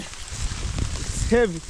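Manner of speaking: very slow strained voiceless words; strained, breathless effort; straining under weight. Real, not acted. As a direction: squeeze it out while lifting a heavy load. Lifting something heavy.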